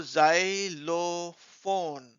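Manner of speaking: xylophone very slowly in three parts, zai-lo-phone; teacher sounding out "xylophone"; syllable by syllable. Shown as a demonstration. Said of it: "छोटे-छोटे parts में उसको".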